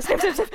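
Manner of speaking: quick sniffs; sniffing the air. Pretend. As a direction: sniff like a dog. Acting a dog sniffing.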